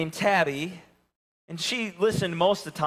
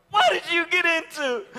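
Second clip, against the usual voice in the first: laughing; 13 semitones higher, 4 dB louder than usual. Real, not acted. Talking to smelly dog.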